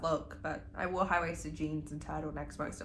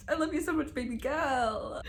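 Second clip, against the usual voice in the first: fangirl gushing; 7 semitones higher than usual. Real, not acted. Declaring love for a character.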